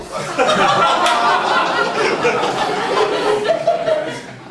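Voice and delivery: high, airy, helpless laughter; breathless wheezing laugh. Real, not acted. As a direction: crack up without voice. Punchline reaction laughter.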